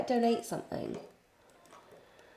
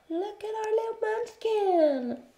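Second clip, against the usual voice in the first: very high, drawn-out gushing; slow, cutesy high voice; 14 semitones higher, slower than usual. Real, not acted. Cooing over the toddler.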